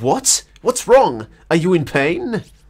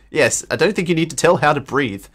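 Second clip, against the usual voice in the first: ordinary talk, nothing marked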